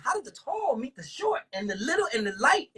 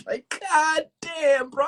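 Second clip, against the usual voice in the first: slow, drawn-out, higher God damn; stunned; 7 semitones higher, 4 dB louder, much slower than usual. Real, not acted. Realizing the man was from Sudan, slow stunned disbelief. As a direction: let the shock stretch the words.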